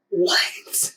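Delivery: breathless, whispered what of disbelief; stunned what. Retold or imitated. Cousin hears his date cheated, a whispered stunned what. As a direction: gasp out the word in shock.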